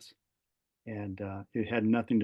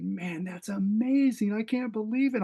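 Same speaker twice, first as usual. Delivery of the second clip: high, slowed, amazed exclamation; excited; 10 semitones higher than usual. Retold or imitated. Husband thrilled by his wife's news, speaking high and amazed. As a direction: let the excitement lift your voice right up. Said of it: "I'm getting excited thinking".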